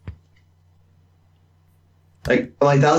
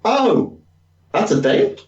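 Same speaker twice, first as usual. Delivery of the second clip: put-on confused young reader; 9 semitones higher, slower than usual. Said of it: "just like".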